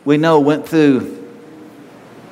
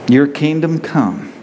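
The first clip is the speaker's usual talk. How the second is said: closing prayer. Acted.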